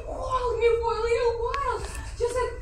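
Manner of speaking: high, half-laughing sob; scared woman sobbing as her outburst ends; laughing through tears. Acted. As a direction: break between a laugh and a cry. She breaks down after shouting.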